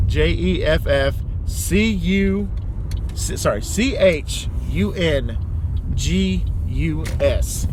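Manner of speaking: spelling it letter by letter, slow; spelled out slowly. Shown as a demonstration. Spelling out the nickname for viewers, slowly. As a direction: spell the name slowly and carefully. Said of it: "So the keywords here are".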